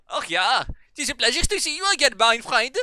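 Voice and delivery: high, loud cartoon German greeting; funny German accent. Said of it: "he had a funny German accent".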